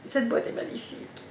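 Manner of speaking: whispered in awe, breathy and soft; admiring whisper. Real, not acted. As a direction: whisper it as if the box is precious. (chuchoté avec admiration)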